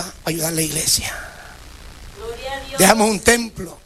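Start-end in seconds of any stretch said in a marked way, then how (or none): 0.0-1.1 susurrado, casi sin voz; díselo en voz baja, como un secreto; susurro. Real, not acted. Confidencia dicha al aire. (en susurro, como confesión a la congregación)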